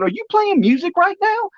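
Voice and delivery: high, amazed asking; amazed question. Retold or imitated. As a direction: ask in wonder, pitch way up. Asks Kaylee, amazed.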